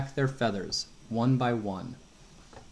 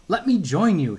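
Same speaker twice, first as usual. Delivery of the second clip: higher, louder, begging to join; eager plea; 6 semitones higher, 6 dB louder than usual. A narrator's reading. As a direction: Coyote pleads eagerly with the crows. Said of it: "he said".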